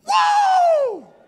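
huge high shout of amazement; triumphant whoa. Acted. David holding up the head to his buddies, huge shout. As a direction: yell it across the valley. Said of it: "looks back at his buddies and goes".

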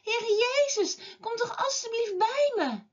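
high, pleading call without much volume; mock shouting. A narrator's reading. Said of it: "Nog harder gaat Bartimeus schreeuwen". (hoog, smekend nageroepen)

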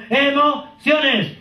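Emociones drawn out very slow and high; slow, sung-out Emociones to drive it home; stretched out. Shown as a demonstration. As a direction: stretch every syllable of Emociones. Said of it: "drawn-out emphasis".

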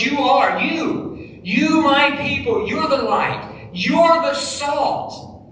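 high, punched stress on "you" and "You're"; emphatic YOU. Retold or imitated. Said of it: "and it's emphatic".

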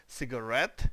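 Cigarette with the stress on RETTE; final-syllable stress. Shown as a demonstration. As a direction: say Cigarette punching the last syllable. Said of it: "читається це слово".

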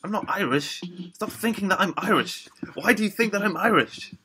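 insisting harder with every line; exasperated rant. Real, not acted. Denying being Irish to listeners, rising exasperated rant. Ranting at people calling him Irish.

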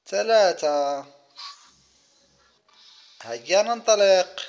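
usual speaking voice

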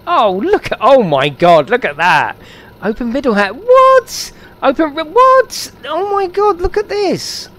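high, loud amazed squeal; real over-excited exclaiming, pitch soaring; getting all excited. Real, not acted. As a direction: shriek with delighted astonishment. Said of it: "Sorry, I'm getting all excited now".

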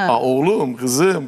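grandmother's Erzurum dialect, fondly imitated; Erzurum accent. Imitating nene addressing the children, Erzurum accent. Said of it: "Ya tabii Erzurum şivesiyle".

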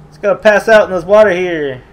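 usual speaking voice

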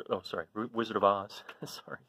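ordinary delivery, nothing marked

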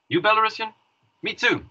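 retold taunt, pitched high; mock challenge. Policeman retelling his taunt to an idiot, mock challenge. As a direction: throw it out high, goading him.

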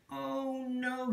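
high, drawn mock cry of dismay. A narrator's reading. Peep waking late for the audition, mildly crying out. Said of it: "he cried".

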